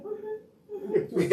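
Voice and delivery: squeaky high laughing mm-hmm; playful high-pitched mm-hmm; high mock reply. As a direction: mimic her chirpy agreement, laughing. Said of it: "She's like".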